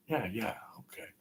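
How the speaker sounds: unvoiced, hushed yeahs; whisper. Real, not acted. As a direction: whisper it under your breath. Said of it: "whispered aside".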